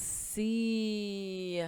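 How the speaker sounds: C stretched into a long, held eee. Shown as a demonstration. Teacher answering whether it has long E, C drawn out. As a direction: draw out the vowel in C. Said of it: "Does it have the long E sound".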